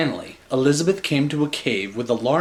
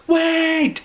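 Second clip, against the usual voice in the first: high, loud call to stop him; shout; 4 dB louder than usual. Acted. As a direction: shout it after the dragon. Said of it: "shouted Elizabeth".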